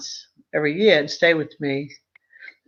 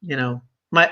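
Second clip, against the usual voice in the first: usual speaking voice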